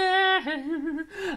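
high, loud crying laugh; squealing laugh. Real, not acted. Overjoyed at the present, a squealing laugh. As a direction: let out a thrilled squealing laugh. Thrilled by gift.

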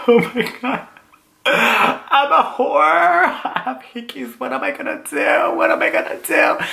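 very high, hammed-up whimpering; mock hysterical wail. Calling his best friend while driving, mock hysterical wail. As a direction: wail it like a melodramatic breakdown.

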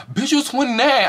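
high, loud disbelief; shocked. Real, not acted. Reacting to the age claim, high and shocked. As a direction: gasp it out in shock.

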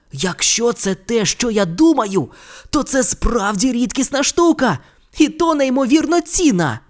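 put-on a boy realising the gift is priceless; lightly voiced Ron, awed.

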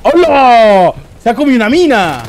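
high, loud burst of surprise; excited shout. Real, not acted. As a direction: yell it out as the mine goes off. Mine explodes on screen. (grito de sorpresa agudo y fuerte)

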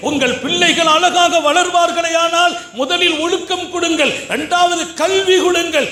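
high, impassioned sermon voice; fiery preaching. Acted. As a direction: exhort the mothers with full force.

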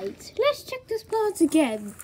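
ordinary talk

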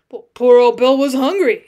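loud protest for the dead pet; defensive interruption. A narrator's reading. Cowboy interrupts to defend dead lion, defensively. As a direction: cut in loudly in defense. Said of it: "interrupted Gibbons in quick defense of the deceased".

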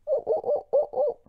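very high, loud ooh ooh ooh monkey call; squeaky monkey hoots. Pretend. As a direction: hoot like a monkey. Monkey sound in the story.

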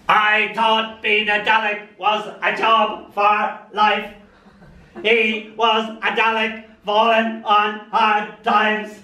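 lightly Dalek-flavoured poem reading. Acted. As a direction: read it half in a Dalek drone.

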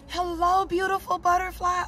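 sing-song hello to a pretty creature; delighted high cooing voice; cooing to a butterfly. Real, not acted. Greeting a butterfly flying by.

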